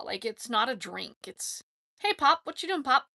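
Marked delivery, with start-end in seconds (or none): 2.0-3.1 lightly acted greeting to dad, higher pitch; mock-address your old Pop, lifted voice; calling to Pop. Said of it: "it's like that".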